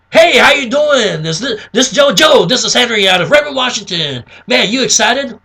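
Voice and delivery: loud, high, over-eager sales pitch; hammed-up pushy salesman greeting; salesy caller. Shown as a demonstration. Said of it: "Don't be salesy".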